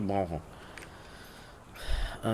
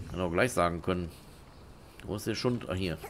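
ordinary talk, nothing marked

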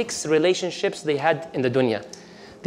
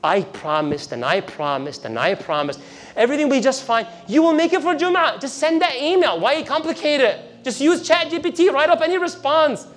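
mocking devil; 7 semitones higher than usual. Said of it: "And he's laughing".

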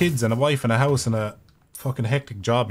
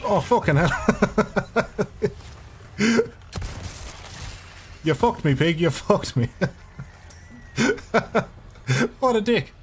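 cracking up; 11 semitones higher than usual. Real, not acted. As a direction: curse helplessly between bursts of laughing. Cracking up at game mishap.

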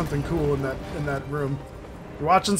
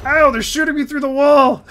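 alarmed shout; 8 semitones higher, 8 dB louder than usual. Real, not acted. Getting shot through wall.

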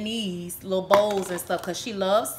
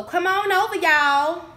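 excited; 9 semitones higher, 6 dB louder than usual. Real, not acted. Inviting viewers loudly.

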